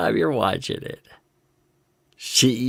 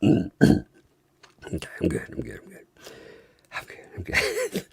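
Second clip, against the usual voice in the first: whispering; 6 semitones lower, 7 dB quieter than usual. Real, not acted. Composing herself again.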